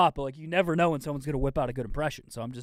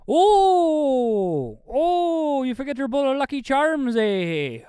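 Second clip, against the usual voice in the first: a much higher, louder hammed-up Irish voice; 10 semitones higher, 6 dB louder, much slower than usual. Host riffs a goofy Irish accent for chat, much higher and louder. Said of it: "Someone just said I did Irish".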